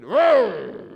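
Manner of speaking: loud mock roar of rage; older brother roaring in anger; angry roar. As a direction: roar like a furious beast. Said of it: "He was angry".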